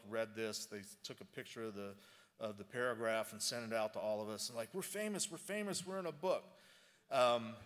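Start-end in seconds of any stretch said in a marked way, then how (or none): 4.7-6.4 excited. Retold or imitated. Colleagues excited about book mention.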